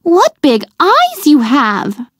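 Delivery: wide-eyed wonder, drawn out; little girl, astonished; girl exclaiming. A narrator's reading. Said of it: "she exclaimed".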